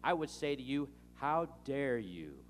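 slow, drawn-out offended "How dare you"; indignant retort. Retold or imitated. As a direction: stretch it out, as if insulted. Scott's indignant answer, retold.

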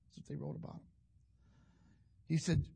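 normal talking voice

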